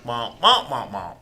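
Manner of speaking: muffled trombone-like wah wah syllables; Charlie Brown teacher wah-wah. Retold or imitated. Preacher imitating how he sounds to the congregation, muffled wah-wah. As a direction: mimic the unintelligible grown-up voice from Charlie Brown. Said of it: "Remember Charlie Brown?".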